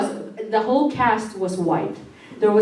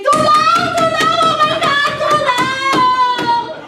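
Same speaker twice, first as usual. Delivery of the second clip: high, loud sing-song screams of Tulong; mock screaming for help; 4 dB louder, much slower than usual. Terrified in her bedroom, screaming for help at the ghost, high shrill wail.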